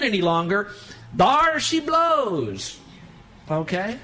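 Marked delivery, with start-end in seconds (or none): 1.1-2.8 stretched-out, much higher and louder burst; slow, soaring there she blows; eruption. Retold or imitated. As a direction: let it blow like a volcano finally going off. Anger finally erupting, preacher dramatizes.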